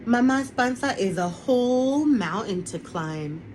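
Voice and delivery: ordinary delivery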